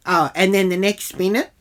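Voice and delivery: ordinary talk, nothing marked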